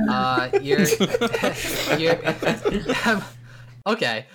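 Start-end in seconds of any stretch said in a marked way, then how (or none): 0.0-3.2 high, breaking into laughter; can't get the words out for laughing. Real, not acted.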